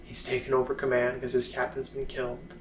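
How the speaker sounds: quietly simmering resentment, long pauses; bitter soldier holding it in, phrase by phrase; pissed off. Acted. Blind soldier refusing a woman's heroic war stories, pissed off but restrained. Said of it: "that's when he kinda gets pissed".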